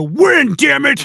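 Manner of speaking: loud, high mock coach bark; angry coach. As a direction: rant like a win-at-all-costs coach. Mock hardline coach rant.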